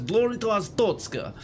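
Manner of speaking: put-on Russian accent with some force; forceful Russian accent. Shown as a demonstration. Gamer showing the intended accent, forceful mock Russian. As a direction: push the salute out in a Russian accent. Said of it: "with a bit of force".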